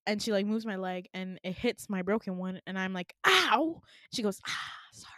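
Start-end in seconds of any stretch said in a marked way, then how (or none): 3.3-3.8 loud, higher yelp of pain; retold "ow", sudden and loud. Patient whose broken leg gets knocked, pained yelp. 4.5-5.1 soft whispered oops; hushed apology. Retold or imitated. Nurse who just hit the broken leg, hushed apology.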